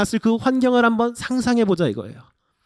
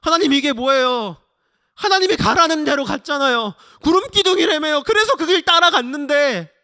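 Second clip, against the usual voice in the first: high, loud aggrieved protest; resentful outcry to God; 8 semitones higher, 5 dB louder than usual. Acted. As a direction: demand answers from God. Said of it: "하나님을 원망하게 됩니다".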